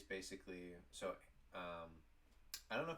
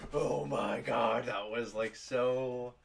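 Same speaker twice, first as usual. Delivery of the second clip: very loud, higher, drawn-out exclamation; retold bursting out; 5 semitones higher, 14 dB louder, much slower than usual. Coworkers reliving the close call together, loud exclamation. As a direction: burst out in exaggerated relief. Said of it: "bursting out laughing".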